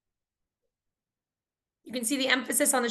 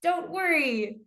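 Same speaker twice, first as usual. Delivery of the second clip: cheering reassurance; 6 semitones higher, slower than usual. Acted. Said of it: "like you're trying to really cheer on the patient".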